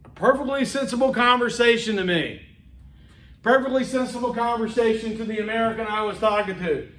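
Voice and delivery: ordinary talk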